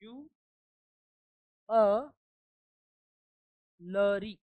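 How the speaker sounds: जू, अ, लरी drawn out syllable by syllable; slow syllable demo. Shown as a demonstration. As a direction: break ज्वेलरी into जू and लरी with long pauses. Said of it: "उस पे stress है".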